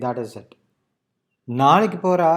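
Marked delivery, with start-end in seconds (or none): 1.6-2.3 நாளைக்கு போறா pitched high and loud; stress on நாளைக்கு போறா. Shown as a demonstration. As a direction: drive home நாளைக்கு போறா. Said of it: "is where I'm focusing on".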